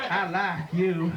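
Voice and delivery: slow, leering drawl; a hint of a creepy mountain man; lightly voiced hillbilly villain. Deliverance villain leering at his victim, slow.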